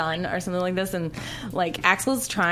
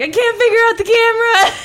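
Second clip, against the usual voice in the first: very high, loud imitated outburst; mock exasperated shout; 11 dB louder than usual. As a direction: yell it out like a flustered cameraman. Imitates flustered cameraman interrupting scene.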